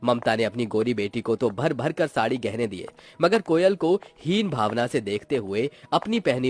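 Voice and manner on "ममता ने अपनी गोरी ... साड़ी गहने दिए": ordinary delivery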